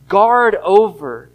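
"guard over" drawn out slowly and loud, every word weighted; slow emphatic stress. Acted. Preacher stressing key phrase.

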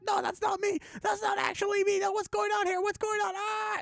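panicked high-pitched yelps, voice shooting way up; teacher denying the posters, squealing in high panic; freaking out. Retold or imitated. Teacher sees the posters of himself, panicked high yelling. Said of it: "freaking out".

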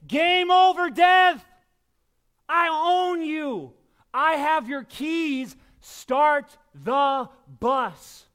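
loud high taunting shout, slowing down; triumphant trash talk. Acted. Jesus taunting death from the sidelines, triumphant shout. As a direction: taunt death like a winning team. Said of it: "That's Jesus saying".

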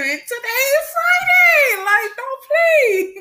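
high, loud squeal that it's Friday; excited shout. Real, not acted. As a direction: shriek with joy that the week is done.

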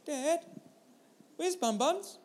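put-on a small girl piping up behind Dad's seat; little girl's voice. Little daughter asking Dad from the back seat, high child voice. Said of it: "I hear a little voice".

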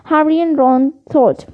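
ordinary delivery

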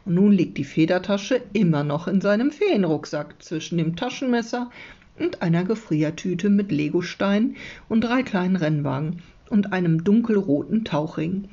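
ordinary delivery

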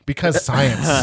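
mocking quote. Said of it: "They said because math and because science".

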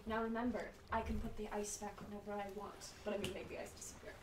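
soft, menacing and fast; quiet threat. Acted. Player threatening prisoner.

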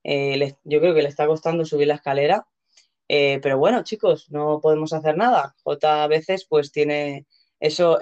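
ordinary delivery

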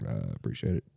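low, very soft mumbled reply; muted thanks. Retold or imitated. Retelling his reply to a flirting customer, muted thanks. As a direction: shrink the thanks right down, imitating awkwardness. Retold awkward reply to compliment.

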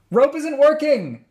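high, slow shout through a radio; over a radio. Acted. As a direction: yell it over a walkie-talkie. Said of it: "Jude's voice rang through the walkie-talkie".

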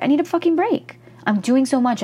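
exasperated outburst. Retold or imitated. Overworked podcaster quoting her past self, exasperated. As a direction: throw it out like you're at the end of your rope.

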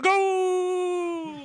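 soccer announcer call. Retold or imitated. Mock Mexican soccer announcer call.

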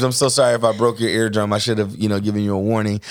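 ordinary talk

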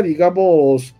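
usual speaking voice

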